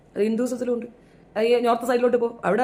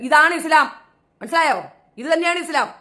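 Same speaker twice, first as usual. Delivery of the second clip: loud, raised, forceful; angry declaration; 6 semitones higher, 5 dB louder than usual. Real, not acted. Angry debater shouting at critics, loud and forceful. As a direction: hammer the point home loudly.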